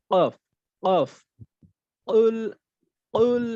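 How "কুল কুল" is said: কুল with a bouncing, echoing ক, higher and louder; qalqalah on qaf. Shown as a demonstration. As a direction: kick the ক in কুল with a sharp rebound. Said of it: "কলকলা করবো।". (কফে কলকলা দিয়ে জোরে কুল)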